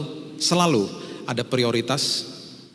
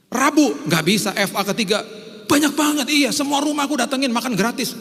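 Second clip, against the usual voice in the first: much higher mock eager believer; mock churchgoer; 12 semitones higher than usual. Preacher acting out an eager churchgoer, high-pitched. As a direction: play the overzealous church hopper. Acted dialogue about free meals.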